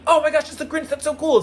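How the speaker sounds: high, thrilled squeal of a fan; excited kid. Retold or imitated. Little kid seeing the Grinch, high excited squeal. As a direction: gush like a starstruck kid.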